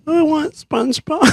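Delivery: small, very high child imitation; little kid's whine. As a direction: whine stubbornly like a little boy. Child repeats demand.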